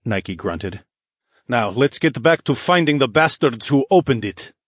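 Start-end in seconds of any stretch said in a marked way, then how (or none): 1.5-4.5 ferocious. Acted. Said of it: "the noble's ferocious choice of words".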